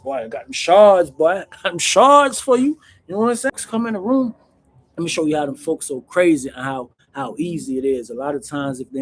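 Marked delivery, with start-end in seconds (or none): 0.0-2.8 higher, louder hyped dealer pitch; hustler pitching dope. Retold or imitated. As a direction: sell it like you're bragging about the product. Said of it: "They be like".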